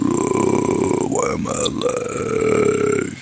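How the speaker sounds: hungover groan. Pretend. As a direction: moan it like waking up hungover. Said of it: "do the whole".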